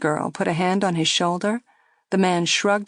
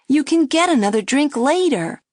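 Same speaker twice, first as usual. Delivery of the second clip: higher, louder coaxing plea; girl coaxing; 8 semitones higher, 5 dB louder than usual. A narrator's reading. Girl coaxing Joey to skip another drink. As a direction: gently press him to stop. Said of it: "the girl said".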